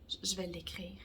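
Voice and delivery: whispered aside to herself. Real, not acted. To herself while writing the title, whispered.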